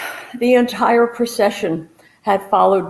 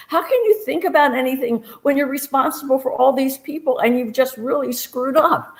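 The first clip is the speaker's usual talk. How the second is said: ordinary talk, nothing marked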